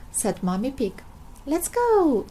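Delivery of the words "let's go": cheering. Acted. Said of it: "cheered Daddy Pig".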